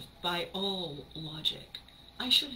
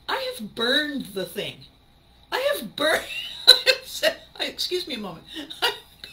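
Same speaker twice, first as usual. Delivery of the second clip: rising, louder laughing sputter; laughing outburst; 7 semitones higher, 7 dB louder than usual. Real, not acted. Host sputters, laughing at friends' lukewarm verdict. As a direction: laugh it out in mock outrage.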